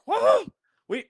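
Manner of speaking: high holler in a put-on voice. Storyteller hollering in a comedian's style. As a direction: holler it like a country comic.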